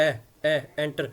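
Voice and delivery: ए, ए stretched out, then एंटर with a clear e at the start; stressing the e sound. Shown as a demonstration. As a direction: drill the ए sound before एंटर. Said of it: "ए की आवाज़ देगा". (ए की आवाज़ पर ज़ोर देकर एंटर)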